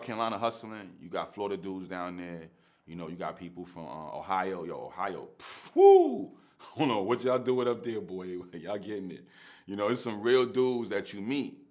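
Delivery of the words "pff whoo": loud, very high whoo after a scoff; whoo of amazement. Real, not acted. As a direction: let out an impressed whoop.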